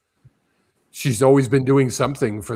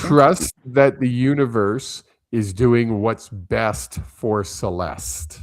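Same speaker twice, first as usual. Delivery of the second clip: slow clear repeat; slower than usual. Real, not acted. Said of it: "I didn't hear what you said".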